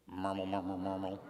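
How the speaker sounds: put-on mumbled baby gurgle; baby babble.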